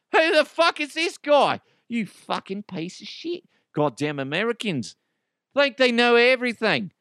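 high, indignant mock Aussie accent; angry Australian. Australians fed up with the American host, mock-angry Aussie accent. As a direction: grumble in a put-on Australian accent. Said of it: "Australian people are like".

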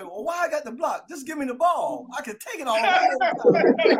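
put-on cocky kid making his case; young player's protest. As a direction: argue for the ball, rising to a loud finish. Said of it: "I was thinking this way".

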